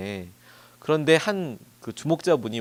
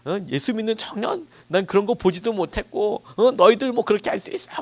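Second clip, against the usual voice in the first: higher, louder retold shouting; mock shouting in disbelief, pitched up; imitated bewildered yell; 8 semitones higher, 3 dB louder than usual. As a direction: act out the stunned man shouting his confusion. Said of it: "그러면서 막 소리 지르다가".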